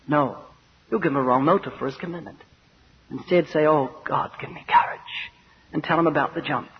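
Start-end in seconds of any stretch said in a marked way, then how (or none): 3.7-5.3 hushed plea for courage; acted under-the-breath appeal to God; whispered prayer. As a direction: breathe it as a private prayer, voice falling away.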